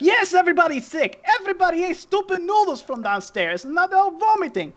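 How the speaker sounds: high-pitched, fed-up yelling. Acted. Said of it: "she starts yelling at Smoke".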